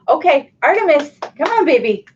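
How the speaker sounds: high, sing-song coo to the pup. Real, not acted. Coaxing her puppy Artemis over in high baby talk.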